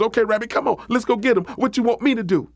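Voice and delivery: high, keen storybook raccoon; eager and ready to go. Acted. Coon eagerly accepts the plan.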